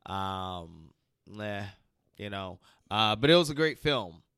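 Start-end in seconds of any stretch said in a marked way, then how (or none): none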